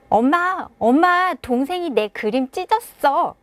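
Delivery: put-on high, upset little child calling mom; a crying five-year-old running to mom; tearful child. Five-year-old runs crying to mom, high upset child voice. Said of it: "아이가 울면서 막 달려옵니다".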